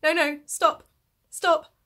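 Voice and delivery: high, loud mock panic; retold freaking out. Host retelling her reaction to the buzzing phone, mock panic. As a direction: act out a flustered, high-pitched panic. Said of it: "I was properly freaking out".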